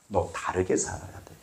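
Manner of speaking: low, very soft admonishment; God's quiet rebuke. Acted. God gently correcting the believer, low and hushed.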